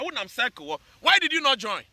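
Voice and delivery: normal talking voice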